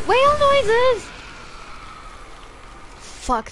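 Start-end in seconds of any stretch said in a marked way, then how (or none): 0.1-1.0 very high, loud squeal of the words; high-pitched mock whale cry; squeaky whale call.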